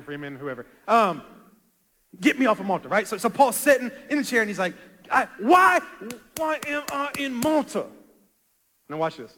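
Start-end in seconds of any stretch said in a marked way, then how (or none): 5.1-7.9 high-pitched mock lament; acted pleading. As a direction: cry out to God in exasperation. Paul voiced pleading with God.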